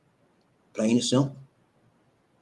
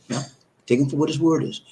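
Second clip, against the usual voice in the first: usual speaking voice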